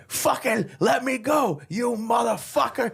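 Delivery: high, strained mock-furious yell; retold shouting. Drunk man pinned down by the bouncer, imitated furious shouting. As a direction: yell it like someone pinned and struggling.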